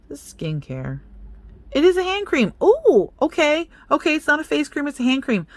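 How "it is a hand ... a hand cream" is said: high, loud burst of delight; excited discovery. Real, not acted. Vlogger finding out it is hand cream, excited. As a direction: pitch leaping up in pleased surprise.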